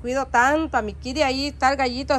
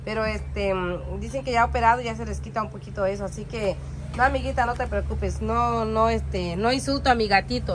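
usual speaking voice

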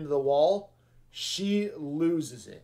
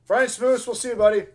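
loud, raised-pitch send-off; called-out farewell; 6 semitones higher, 6 dB louder, faster than usual. Real, not acted. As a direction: call goodbye to a buddy. Calls goodbye to a leaving viewer.

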